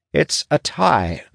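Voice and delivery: high, drawn-out announcement; proclaiming scribe. A narrator's reading. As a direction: proclaim it slowly on a high pitch. Scribe proclaims the vote result.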